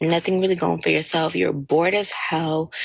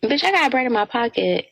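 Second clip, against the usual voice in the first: sassy comeback; 6 semitones higher than usual. Retold or imitated. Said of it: "I'm like".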